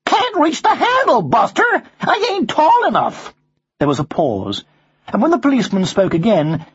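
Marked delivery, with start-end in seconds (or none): 0.0-3.3 very high American parrot; high-pitched cheeky American bird; American accent. Acted. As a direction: explain like a high-voiced American wise guy. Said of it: "you have an American accent".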